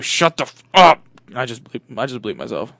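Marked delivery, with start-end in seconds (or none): 0.0-1.0 loud outburst cutting off friend; exasperated yell. Acted. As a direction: blow up at him, peaking on the last word. Acted quarrel in the car.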